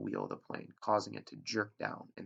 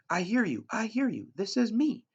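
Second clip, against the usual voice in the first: high, loud desperate relief; urgent raised shouting; frantic reply; 13 semitones higher, 11 dB louder than usual. Acted. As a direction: blurt it out the instant the radio answers. Boy answers radio voice frantically.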